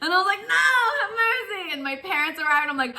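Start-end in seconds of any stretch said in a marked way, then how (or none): 0.4-1.7 high, crying mock plea; hammed-up cry for mercy; mock pleading cry. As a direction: beg for mercy in playful panic. Thrown on bed by brother.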